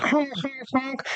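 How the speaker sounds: high, soft honking like geese; goose honks. Acted. GM voicing the geese blocking the ford, high honks. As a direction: honk like a flock of geese. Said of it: "the geese are like".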